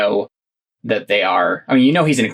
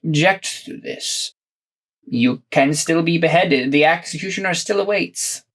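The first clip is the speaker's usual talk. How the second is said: menacing inquisitor; 5 semitones higher than usual. Acted. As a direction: threaten them smugly, drawing it out. Inquisitor threatens prisoners.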